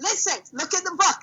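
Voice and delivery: high-pitched warning to the dog; high scolding call. Real, not acted. Scolding the dog off the book, high and sharp.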